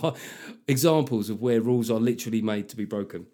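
ordinary talk, nothing marked

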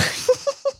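high burst of laughter. Real, not acted. Laughs at the question.

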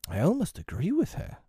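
high-pitched, mildly snappy; a touch of irritation; snappish. A narrator's reading. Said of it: "Wendy snapped".

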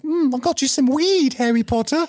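high, squeaky house-elf dealer; Dobby impression. Dobby shows up, offering weed.

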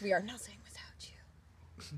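hushed, pleading fade-out; barely voiced mock devotion; mock heartfelt whisper. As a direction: whisper it like a melodramatic plea. Mock plea for host to stay.

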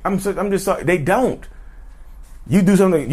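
normal talking voice

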